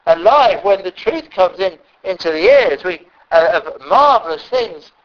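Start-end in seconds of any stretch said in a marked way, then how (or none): none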